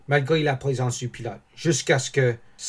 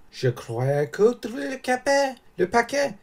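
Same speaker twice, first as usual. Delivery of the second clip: insinuating schemer; 11 semitones higher than usual. Acted. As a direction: drop a hint as if innocently. Danglars insinue, voix contrefaite du personnage. (voix aiguë et lente d'intrigant)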